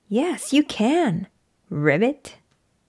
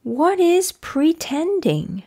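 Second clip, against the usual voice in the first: higher, curious wondering; puzzled question; 6 semitones higher than usual. Acted. Gerald asking Piggie, higher and puzzled. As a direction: ask it wide-eyed and innocent.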